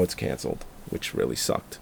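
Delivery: dropped to a near whisper; a disappointed murmur, barely voiced; muttered aside. Real, not acted. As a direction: trail off under your breath, let down. Disappointed aside about cancelled show.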